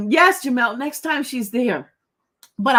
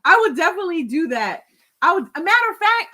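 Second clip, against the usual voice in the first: fast, high, excited rush; gush, giddy with delight; 5 semitones higher, 5 dB louder, faster than usual. Real, not acted. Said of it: "how it's making me giddy".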